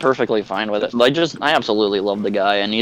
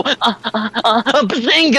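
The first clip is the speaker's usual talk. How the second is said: mock Sheldon moan; 11 semitones higher, slower than usual. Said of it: "he sounds like fucking Sheldon Cooper".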